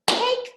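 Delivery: CAKE in one high, punched beat; single clapped beat. Shown as a demonstration. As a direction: hit CAKE once, high and crisp. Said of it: "I just hear one syllable".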